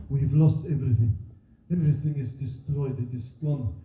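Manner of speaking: halting English in a put-on Syrian accent; mock foreign accent. Acting a Syrian family deciding who walks to Dover, foreign accent. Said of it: "unclear why her accent is so different".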